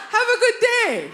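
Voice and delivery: high, loud mock butler greeting; a hint of a servant's bow in the voice; butler ghost. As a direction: play a posh ghost butler welcoming her home. Butler ghost seeing her off.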